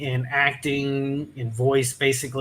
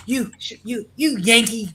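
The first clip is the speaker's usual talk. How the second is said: higher-pitched mock-angry jab; imitating an indignant friend; sputtered mock accusation, pitched up; retold mad insult; 6 semitones higher than usual. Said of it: "she got mad at me for something".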